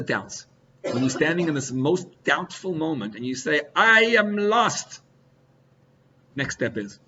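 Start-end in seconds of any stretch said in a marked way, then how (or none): 3.7-5.0 lost cry. Pretend. Cry in the doubtful moment.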